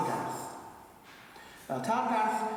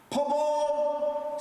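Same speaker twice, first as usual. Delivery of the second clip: ringing call; 9 semitones higher than usual. Retold or imitated. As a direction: cry it out to the people. Quoting the teacher's Welsh cry.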